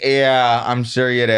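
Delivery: sarcastic. Retold or imitated. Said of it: "They're like".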